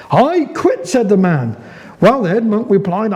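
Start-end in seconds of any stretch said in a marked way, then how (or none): 0.1-0.9 sudden high exasperated blurt; just blurt it out, fed up. Acted. Monk finally blurts out.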